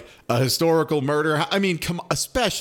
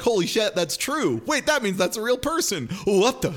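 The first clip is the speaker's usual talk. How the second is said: higher, excited disbelief; mock astonished viewer; 7 semitones higher than usual. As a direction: gasp at the discovery. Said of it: "they look that up and they're like".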